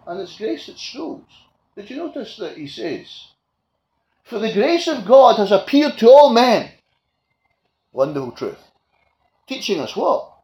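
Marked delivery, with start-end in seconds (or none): 4.2-6.7 loud, higher scripture quote; emphatic preaching. Acted. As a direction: ring out all men.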